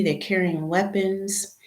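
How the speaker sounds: ordinary delivery, nothing marked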